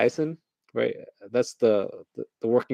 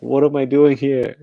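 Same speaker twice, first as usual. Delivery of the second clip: raised, louder baffled protest; mock bewilderment; 4 semitones higher, 6 dB louder than usual. Newscasters dumped in Norway, mock bewilderment. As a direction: cry out as if lost, playfully.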